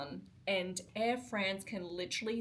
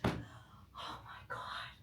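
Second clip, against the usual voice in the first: slow, breathless whisper; drained whisper after losing the luggage; whispered oh my God; 8 dB quieter, much slower than usual. Real, not acted. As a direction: whisper it in stunned disbelief. Exhausted disbelief after the airline rant.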